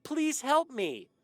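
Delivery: high, loud, drawn-out plea; hammed-up little boy screaming; mock scream. A narrator's reading. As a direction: scream slowly like a child in flames, cut off. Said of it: "Arnold stopped in mid-scream".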